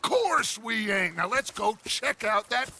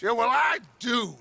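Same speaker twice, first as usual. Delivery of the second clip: raised voice; 4 semitones higher, 4 dB louder than usual. Acted. Arguing against a doubting gang member.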